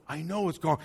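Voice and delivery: soft, low, patient reply; quietly concede, acting the comforter; calm reassurance. Calm reply to upset Mary.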